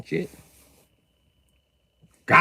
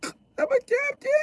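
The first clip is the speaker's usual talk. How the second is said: timid squeak; 5 dB quieter than usual. Acted. Voicing timid Capricorn reply.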